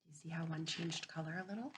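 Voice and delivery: soft, half-whispered noticing; hushed think-aloud. Real, not acted. Teacher quietly pointing out pictures to children. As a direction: murmur it like sharing a discovery.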